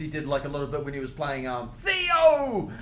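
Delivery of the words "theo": high drawn-out call, put-on sitcom dad voice; Cosby. Mid-story impersonation of a sitcom scene, high Cosby voice. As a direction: stretch the name into a high comic call. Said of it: "practicing his Cosby".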